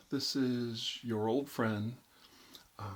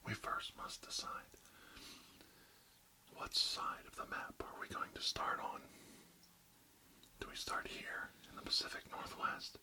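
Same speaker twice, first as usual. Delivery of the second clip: ASMR whisper, close to the mic; barely audible, intimate whisper; 5 semitones higher than usual. Acted. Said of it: "real close to inaudible whispers today".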